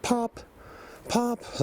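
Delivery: high, sharp "pop, pop" imitating two knocks; mock wood-knock pops, very high; imitated wood knock. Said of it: "they were done just, like".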